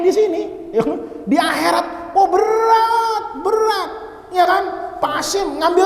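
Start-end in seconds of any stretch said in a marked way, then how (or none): 2.2-3.9 "berat" stretched long and high; high, lingering groan on "berat"; drawn-out BERAT. Acted. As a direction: draw out "berat" as if it weighs a ton. Stressing the burden in the afterlife.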